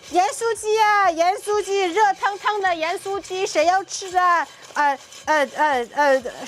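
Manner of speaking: high, loud Taiwanese street vendor call; Taiwanese accent. Acted. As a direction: hawk food with a Taiwanese accent. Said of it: "That's the best Taiwanese accent I got".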